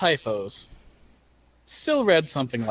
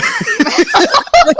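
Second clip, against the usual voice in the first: shrieking laugh; 10 dB louder than usual. Real, not acted. Laughing at the Hulk joke.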